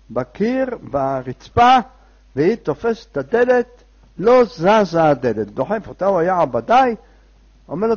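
usual speaking voice

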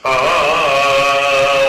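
long, loud held "aah"; acted startled wail. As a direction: draw out one loud, droning cry. Husband jolted awake by shouting. (זעקת בהלה מוצגת, ארוכה)

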